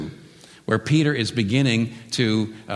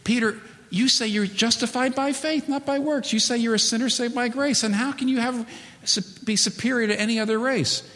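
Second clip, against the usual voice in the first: Paul rebuking Peter; 12 semitones higher than usual. Acted. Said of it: "what he says is".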